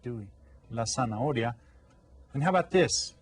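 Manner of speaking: ordinary talk, nothing marked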